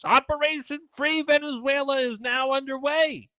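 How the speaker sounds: high, hammed-up headline reading; mock the pompous war post; mock dramatic announcement. Reading out a gleeful war post as a mock announcement.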